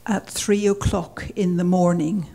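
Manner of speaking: ordinary talk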